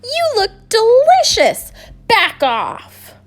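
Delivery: high, squeaky little bee shouting a warning; a tiny bee snapping at a giant; defensive bee. Acted. Bee warns the hungry person off, high and squeaky.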